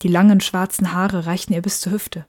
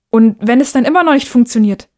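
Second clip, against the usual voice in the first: higher, louder, worried push; anxious question; 4 semitones higher, 6 dB louder than usual. Acted. Boy fears the window stays shut, anxious.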